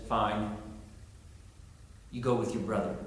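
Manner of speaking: low, soft, resigned concession; grudging God. Retold or imitated. God finally gives in to Moses, grudgingly resigned. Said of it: "God finally gives in and says".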